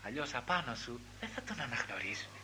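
hushed, breathy whisper to the listener; soft intimate whisper from the pulpit. Acted. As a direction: lean in and whisper it like a secret. Preacher drops to whispered warning.

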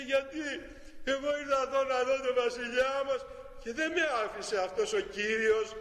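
put-on a child complaining through tears; upset child. Crying child explains to prince. (παιδί που παραπονιέται, μισοκλαμένα)

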